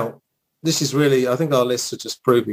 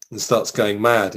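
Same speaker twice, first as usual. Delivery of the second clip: ordinary talk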